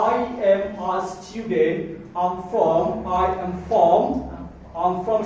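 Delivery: "I am a student" said very slowly; slow student reply. Shown as a demonstration. Teacher acting a student's slow self-introduction. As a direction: model a child's answer slowly. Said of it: "আপনার বাচ্চাকে শিখাবেন".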